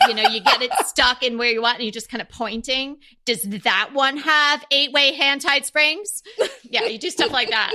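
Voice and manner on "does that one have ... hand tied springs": slow, higher, deliberate quizzing; pointed shopper's question. Acted. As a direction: act out a savvy customer grilling the salesperson. Acting out shopper pointing, quizzing salesperson.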